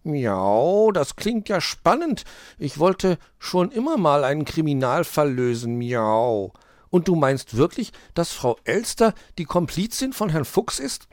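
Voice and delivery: high, loud cat voice with long miaows; keen tomcat detective. A narrator's reading. Mauz the cat joins the investigation, intrigued. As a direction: meow it out, thrilled by the case.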